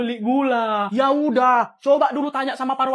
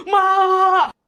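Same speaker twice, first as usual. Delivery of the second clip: loud, higher cry of despair; exasperated shout; 6 semitones higher, 5 dB louder than usual. Acted. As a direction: throw your hands up and yell. Exasperated exclamation. (teriakan putus asa)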